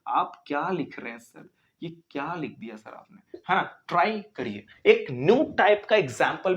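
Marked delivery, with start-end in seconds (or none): 0.1-3.4 quiet, lightly voiced student teasing the teacher; soft mock whine from a student. Teacher mimics student's soft complaint.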